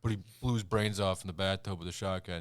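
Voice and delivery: imitating the character's backstory line; mock brooding rapper. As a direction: deliver it as a hammed-up tragic backstory. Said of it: "he's like".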